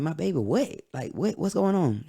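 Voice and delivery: retold alarm, pitch jumping up; alarmed questioning. Father figure questioning grandma on the phone, alarmed. Retold shock at bad news.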